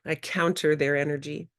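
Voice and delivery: usual speaking voice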